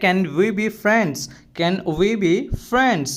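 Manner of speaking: slow, carefully spelled-out English phrase; slow and clear classroom model phrase; slow teaching demo. Shown as a demonstration. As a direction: say each word slowly for the learner. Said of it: "इसे हम English में बोलेंगे".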